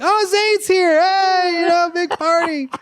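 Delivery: high, loud excited greeting; delighted moms greeting the meat salesman; excited hosts. Retold or imitated. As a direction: welcome the party guy like an old friend. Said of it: "they're like".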